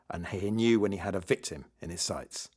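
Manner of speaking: ordinary delivery, nothing marked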